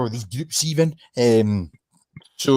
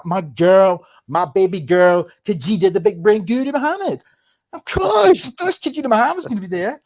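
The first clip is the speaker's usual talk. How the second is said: loud, higher mock adoration; sarcastic gushing; 5 semitones higher, 6 dB louder than usual. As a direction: fake affection dripping with sarcasm. Sarcastic about a disliked official.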